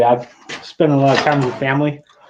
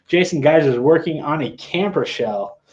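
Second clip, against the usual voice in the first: usual speaking voice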